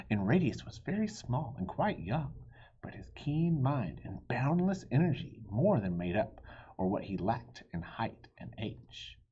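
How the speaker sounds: ordinary delivery, nothing marked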